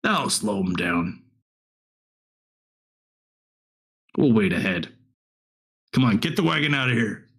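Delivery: low, unhurried heavy's voice. Acted. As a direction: give orders as a large, deep-voiced thug. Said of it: "They sound like a bigger individual".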